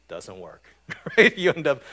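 amused at his own joke; laughing. Real, not acted. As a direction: chuckle through the words, then a loud laughing right.

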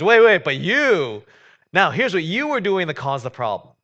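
a parent stopping the gloater cold; parent turning on child. Retold or imitated. Parent rounds on the laughing sibling, loud and high. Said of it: "turns to the other and goes".

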